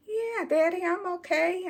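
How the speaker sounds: put-on high, sweet kid reply; girl calling down from a tree; little girl voice. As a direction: answer like a small child. Child answering from tree.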